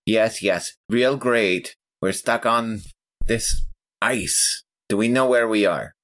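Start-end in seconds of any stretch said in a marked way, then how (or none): none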